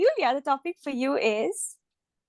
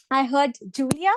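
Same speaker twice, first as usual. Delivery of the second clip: ordinary delivery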